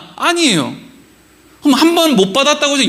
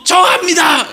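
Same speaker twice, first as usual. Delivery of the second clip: quick, higher-pitched eager shout; eager child answering; 6 semitones higher, slower than usual. Retold or imitated. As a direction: imitate a pupil bursting to answer. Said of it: "한 아이가 손을 들며".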